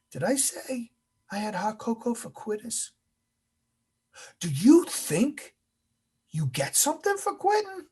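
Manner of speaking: high rhetorical questions with long pauses; instructor's incredulous challenge. Retold or imitated. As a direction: pitch it up in mock disbelief at the trainees. Instructor scolds class over megaphone.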